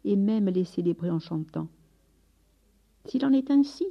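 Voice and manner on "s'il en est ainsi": a higher, louder cry of acceptance; fervent cry. A narrator's reading. The sick beggar cries out to heaven in a higher, louder fervent cry. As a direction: throw the words out, welcoming the suffering. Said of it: "cria-t-il".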